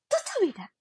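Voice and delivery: high-pitched mock pleading; mock protest. Embarrassed student answering her friends, mock protest. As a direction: jump up high in playful protest. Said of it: "And I was like".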